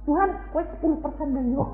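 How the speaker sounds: high, fast bargaining with God; mock bargaining prayer. Rich believer offers God ten percent, high and quick. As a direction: haggle lightly with heaven. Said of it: "Nanti nek sudah duit akeh".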